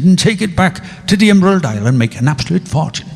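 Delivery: put-on scheming Irishman, in an Irish accent. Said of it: "the Irish accent".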